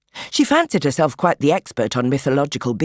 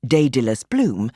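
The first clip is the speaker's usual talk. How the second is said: usual speaking voice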